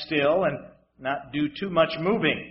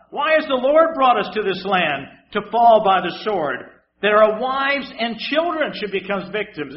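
louder, accusing wail; loud accusing complaint; 4 semitones higher, 7 dB louder than usual. Acted. Preacher voicing the Israelites accusing God, louder wail.

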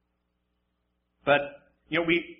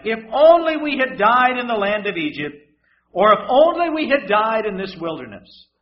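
loud, raised complaint; loud complaining; 5 semitones higher, 8 dB louder than usual. Acted. Preacher reading the Israelites' complaint, loud and raised. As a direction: cry out the people's grumbling.

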